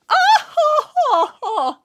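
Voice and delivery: high, loud haughty "oh ho ho"; a tiny noble's mocking laugh; Shantoto laugh. Shantoto laughs haughtily, high and loud. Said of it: "wie Shantoto klingt".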